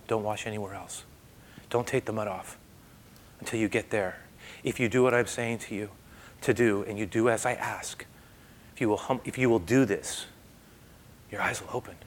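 soft, low, earnest directions; quiet instruction. Retold or imitated. Jesus instructing the blind man, soft and low. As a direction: say it softly like a calm command.

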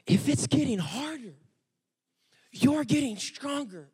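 serious coaching. Retold or imitated. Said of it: "And I got serious".

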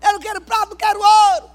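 birra exaltada e aguda com Deus; protesto gritado. Acted. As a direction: recuse gritando, esticando a última palavra. (grito teatral de protesto)

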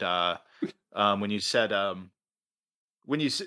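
normal talking voice

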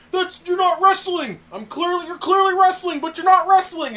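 high, loud exasperated rant. Retold or imitated. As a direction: scold him in mock outrage.

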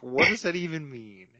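high, loud baffled outburst; bewildered yelp. Retold or imitated. Reacting to Troy's weird corn analogy, high and loud in disbelief.

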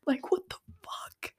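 voiceless, exasperated whisper; appalled whisper. Real, not acted. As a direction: whisper it, utterly baffled. Appalled reaction to the plot.

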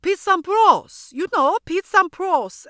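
loud, high, exaggerated French accent; Julien. French student repeats the name, exasperated French accent. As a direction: insist on it in a thick French accent. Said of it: "I didn't understand his accent".

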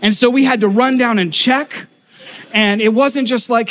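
usual speaking voice

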